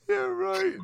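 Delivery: words squeezed out through high laughter; laughing. Real, not acted. Laughing hard at the story.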